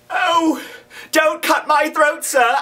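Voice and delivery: put-on high, frightened child's plea; pleading in terror. A narrator's reading. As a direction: beg for your life, voice up high. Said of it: "I pleaded in terror".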